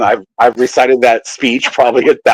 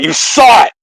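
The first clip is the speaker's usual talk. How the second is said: higher, louder excited cry; excited call-out; 9 semitones higher, 4 dB louder than usual. Retold or imitated. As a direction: shout it out in triumph. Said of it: "he calls him out".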